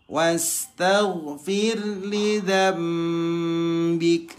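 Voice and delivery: chanted with throaty gh sounds; Quran recitation. Shown as a demonstration. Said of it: "Hati-hati ya". (bacaan tajwid dengan ghin jelas)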